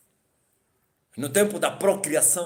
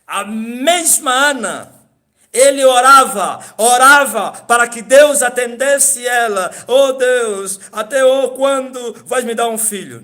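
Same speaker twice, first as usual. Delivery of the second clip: high, loud and slow fervent preaching; 8 semitones higher, 11 dB louder, slower than usual. Real, not acted. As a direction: preach it with full force. Preaching Hannah's prayer. (pregação fervorosa e alta)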